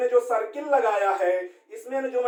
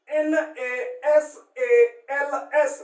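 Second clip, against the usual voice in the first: slow spelling; 2 semitones higher, much slower than usual. Shown as a demonstration. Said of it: "नेसल्स".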